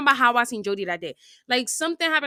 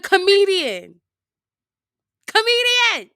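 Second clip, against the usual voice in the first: high, loud name call, rising in force; escalating mock yell; acted loud calling-out; 9 semitones higher, 7 dB louder, slower than usual. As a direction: call him out louder and higher the second time. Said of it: "I'm sorry, y'all, if y'all have headphones in".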